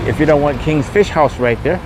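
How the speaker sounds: ordinary talk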